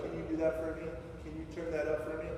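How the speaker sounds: quiet mimicked request; helpless attitude. Salesperson asking to have it done for them, helpless attitude. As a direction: mimic a needy rep asking softly. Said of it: "have that attitude of like".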